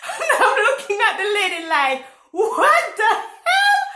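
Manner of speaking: high, loud laugh-squeal of disbelief; incredulous laughing. Retold or imitated. Telling viewers about a rude customer, incredulous laughing. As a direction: blurt it out through laughter, pitch shooting up.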